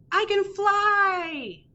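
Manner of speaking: high, slow, proud bird voice. Acted. Bird boasting to the crab, high slow voice. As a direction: announce it high and slowly.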